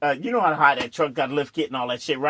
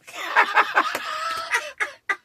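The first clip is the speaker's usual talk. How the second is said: shouted laugh. Real, not acted. Laughing hard telling friends about the stuck truck. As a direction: howl at the punchline.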